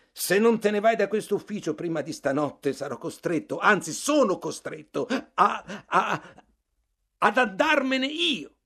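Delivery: alto e forte, impappinandosi in collera; minaccia furiosa. Acted. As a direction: minaccia a vuoto, si incaglia su a, a. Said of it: "conclusi in maniera piuttosto assurda". (il narratore minaccia Bartleby infuriato e balbettante)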